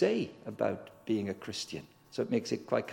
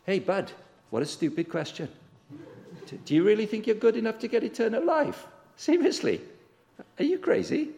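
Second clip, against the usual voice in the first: higher, incredulous mock scolding; abrupt Jesus; 8 semitones higher than usual. Preacher voicing Jesus rebuking the man, mock abrupt. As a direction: scoff at the question like a blunt friend. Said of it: "he could have put it a bit more abruptly".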